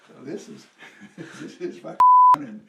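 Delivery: words breaking up into laughter; loud, high, amused retelling of being stunned; laughing disbelief. Retold or imitated. Newcomer meeting a naked woman in California, laughing disbelief.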